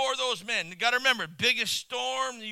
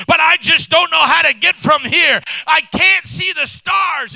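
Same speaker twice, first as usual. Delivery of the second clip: loud, high fervent preaching; preaching at full force; 6 semitones higher, 16 dB louder than usual. Acted. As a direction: preach it with fire. Preaching climax.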